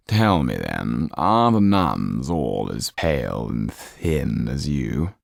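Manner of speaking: very slow, low and silky; sly Count. Acted. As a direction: savour each word, amused. Count questions Sido.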